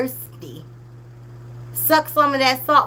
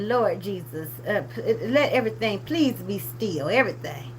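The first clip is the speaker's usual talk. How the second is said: weary prayer; 5 semitones lower, 6 dB quieter than usual. Retold or imitated. Vlogger praying for calm after watching the beach clip, hushed. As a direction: pray for calm, worn out. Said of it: "I said".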